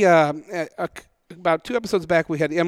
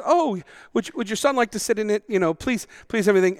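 higher-pitched, polite British gentleman; British accent; 6 semitones higher than usual. Retold or imitated. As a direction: imitate a gracious British host. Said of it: "Carl has a lovely British accent".

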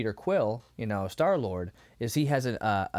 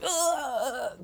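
drawn-out higher-pitched "ugh"; imitated strangled groan; 11 semitones higher than usual. Imitating the possessed man lifted into the air, mock groan. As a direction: groan like someone seized by a demon.

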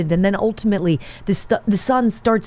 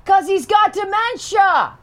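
high, shouted punchline; 11 semitones higher than usual. Real, not acted. Host joking about the grandpa who scares him, shouted. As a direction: yell the last words out.

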